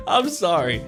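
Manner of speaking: normal talking voice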